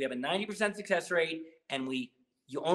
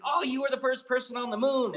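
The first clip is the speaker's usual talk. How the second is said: higher-pitched mock admiration; gushing compliment; 7 semitones higher than usual. Complimenting an Irish person, gushing in a higher pitch. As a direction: play up an over-the-top compliment. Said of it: "I like complimenting people".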